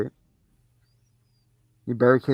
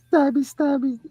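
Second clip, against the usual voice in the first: playful mock; 12 semitones higher than usual. Mocking Chucky's stabbing.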